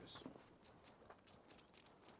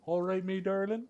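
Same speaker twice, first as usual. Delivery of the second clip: a light West Country "all right, me darling", higher and louder; West Country accent; 8 semitones higher, 5 dB louder than usual. Retold or imitated. Streamer imitates the NPC's West Country accent. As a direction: greet like a jolly West Country local. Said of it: "West East Country accent there".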